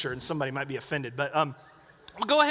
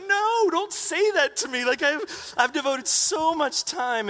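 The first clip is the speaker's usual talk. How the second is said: much higher, slow, pleading whine; mock whiny protest; 9 semitones higher, 4 dB louder, slower than usual. Husband protests to wife, mock whiny plea. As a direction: whine it like a hurt kid begging. Protesting his wife's remark.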